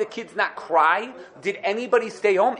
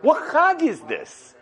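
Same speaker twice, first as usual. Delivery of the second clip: mock astonished Pharaoh; 10 semitones higher, 3 dB louder than usual. Pharaoh demanding of Moshe what holiday this is, high and incredulous. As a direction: pitch it up in mock disbelief. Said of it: "he looked at Moshe and he said".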